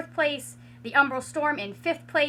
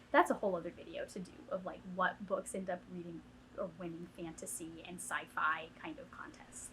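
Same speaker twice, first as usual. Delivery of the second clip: whispered aside; 4 semitones lower, 9 dB quieter than usual. Real, not acted. Side remark about another video.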